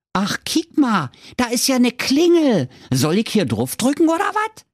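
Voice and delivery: put-on high, cheeky kid voice with Berlin twang; cheeky little boy. A narrator's reading. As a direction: play the bold little brat. Däumling finds the doorbell.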